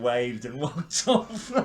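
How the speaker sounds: breaking into laughter mid-phrase; laughing. Real, not acted. Comedy fan telling of his friend's shout, laughing. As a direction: laugh through the end of the story.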